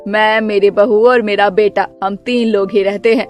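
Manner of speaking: low, bossy mother-in-law voice, stretched call; stern mother-in-law. Acted. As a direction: play the grumpy saas calling her daughter-in-law.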